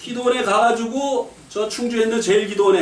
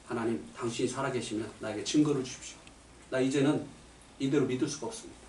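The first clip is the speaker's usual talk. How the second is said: earnest plea; 9 semitones lower, 13 dB quieter than usual. Retold or imitated. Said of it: "뭐냐면".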